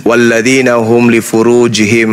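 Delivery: slow chanted Arabic recitation; Quran recitation. Acted. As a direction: recite the verse slowly, reverently.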